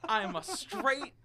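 high, loud, slow mock-solemn declamation; measured pundit proclamation, punching up each phrase; Stephen A. Smith. Pundit confessing to the turkey, high slow hammed-up declamation. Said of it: "what Stephen A quote would be your reaction".

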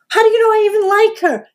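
hammed-up indignant question to his wife; mock indignation. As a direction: play John caught off guard. John voiced in retelling.

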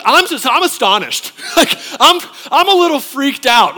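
loud, high, laughing disbelief; hammed-up astonishment at the broadcast; mock astonished. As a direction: act out stunned shock with a laugh. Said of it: "you'd be like".

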